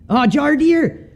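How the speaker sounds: high, drawn-out delighted call; sudden delighted shout of recognition; excited greeting. Real, not acted. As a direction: happily call out a friend's name. Spots Jardier racing.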